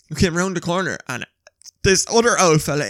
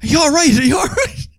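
loud, high urgent call; 11 semitones higher, 7 dB louder than usual. Retold or imitated. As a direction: shout across the road to the injured man. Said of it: "We were like".

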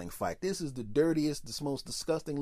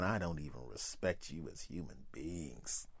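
much lower, much softer disdain; low contemptuous murmur; 12 semitones lower, 11 dB quieter than usual. Retold or imitated. Judging the people in line, low and softer with contempt. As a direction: sneer it quietly, dripping with contempt. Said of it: "I'm going".

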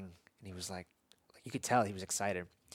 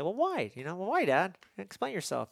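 higher, lightly voiced child demanding why; questioning six-year-old; 7 semitones higher than usual. Six-year-old son asks his dad why he is angry, higher. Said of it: "But then he'll say".

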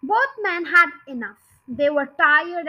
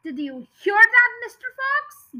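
raised, high-pitched calling question; storybook yell; 8 semitones higher, 4 dB louder than usual. A narrator's reading. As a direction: call it out loudly, rising high at the end. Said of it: "yelled Bean".

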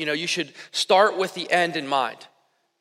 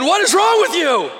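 much higher and louder exasperated yell; retold scream at baby; 11 semitones higher, 7 dB louder than usual. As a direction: snap at the crying baby in frustration. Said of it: "I screamed at a baby".

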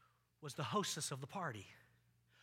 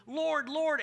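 mock pleading cry; 10 semitones higher, 8 dB louder than usual. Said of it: "a group of people go".

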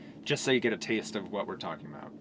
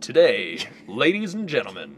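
radio announcer voice; 4 dB louder than usual. Pretend. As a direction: boom it like a ringside announcer. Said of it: "your radio announcer voice".

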